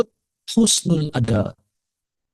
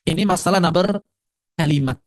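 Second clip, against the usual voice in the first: ordinary delivery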